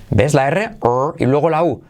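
usual speaking voice

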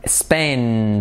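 each short word spaced out slowly; very slow drill. Shown as a demonstration. Teacher drilling short vowels very slowly. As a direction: say "It's in Spain" very slowly. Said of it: "cortas y cortas".

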